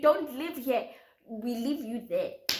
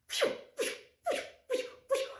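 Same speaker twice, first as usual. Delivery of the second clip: whooshing car noise. Retold or imitated. Imitating speeding car.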